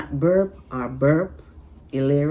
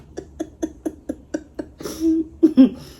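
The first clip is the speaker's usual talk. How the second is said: loud high laughter; 5 dB louder than usual. Real, not acted. Laughs hard at burps.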